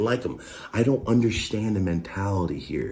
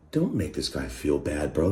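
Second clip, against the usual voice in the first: little inner voice; 4 semitones lower than usual. Retold or imitated. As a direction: play your own small inner voice, pitched down. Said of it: "little me is going".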